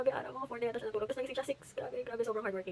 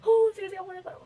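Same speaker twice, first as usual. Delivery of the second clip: excited; 10 semitones higher, 9 dB louder than usual. Real, not acted. Exclaiming relief, loud high far.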